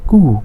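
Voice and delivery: Kuh said as long "koo"; German letter Q. Shown as a demonstration. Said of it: "not similar to the English one".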